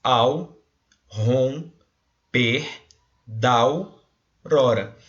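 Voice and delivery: slow scansion, each syllable set off; syllables spoken apart. Shown as a demonstration. Teacher to class, scanning the verse slowly. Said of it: "ó".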